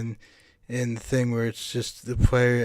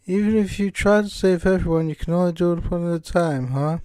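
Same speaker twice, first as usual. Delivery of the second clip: higher British character voice; British-accented game character, raised pitch; Monica; 6 semitones higher than usual. Acted. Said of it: "can't shake this British accent".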